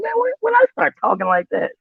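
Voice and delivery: high, startled squeak at himself; mock shocked high voice; mock surprise. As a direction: squeal in disbelief at his own slang. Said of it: "I'm like".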